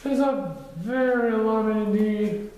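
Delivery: high, loud bear admiring; proud Pooh. A narrator's reading. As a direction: admire himself in the mirror. Pooh's voice.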